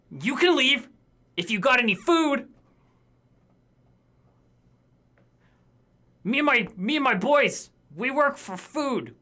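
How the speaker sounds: lightly voiced hulking bugbear. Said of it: "He goes".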